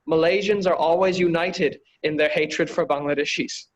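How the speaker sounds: ordinary delivery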